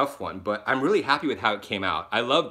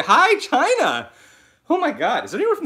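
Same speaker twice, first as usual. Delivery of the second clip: excited greeting; 13 semitones higher, 7 dB louder than usual. Real, not acted. Greeting viewers on live stream.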